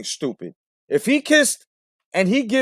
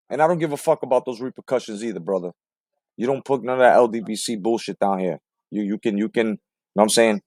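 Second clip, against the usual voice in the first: ordinary talk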